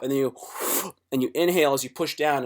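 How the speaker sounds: normal talking voice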